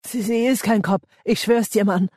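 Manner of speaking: high, urgent pleading; desperate denial. A narrator's reading. Ben defends Andy at gunpoint, desperate. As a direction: stumble into it, swearing it's true.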